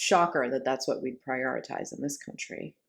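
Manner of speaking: deadpan sarcasm about the government; sarcastic. Real, not acted. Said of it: "I'm being sarcastic".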